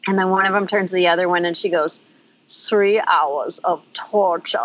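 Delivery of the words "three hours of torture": put-on slow, heavy German accent. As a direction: drag it out like an old German lady. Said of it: "in a German accent".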